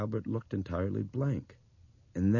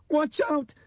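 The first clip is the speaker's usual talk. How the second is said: put-on very high, loud, urgent girl's cry; sharp warning cry; 6 dB louder than usual. A narrator's reading. Dinah hears danger coming, sharp warning cry. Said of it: "Dinah cried sharply".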